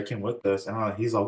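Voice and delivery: ordinary delivery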